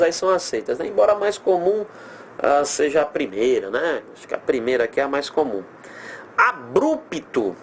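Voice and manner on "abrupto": Abrupto said slowly with a br cluster; slow demo word. Shown as a demonstration. Teacher slowly showing the usual Abrupto. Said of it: "ambas as grafias são aceitas".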